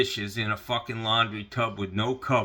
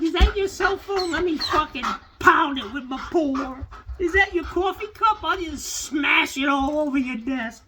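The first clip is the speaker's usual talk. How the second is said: high, louder cheeky pet voice; voicing the dog; 5 dB louder than usual. Dog taunting owner about smashing his phone and cup, high cheeky voice. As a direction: play the dog as a little troublemaker. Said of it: "She's like".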